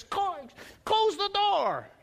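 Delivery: put-on high, slow grumbling old man; cranky grandfather. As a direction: bark at your wife to shut the door. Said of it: "only time I ever heard Jesus' name".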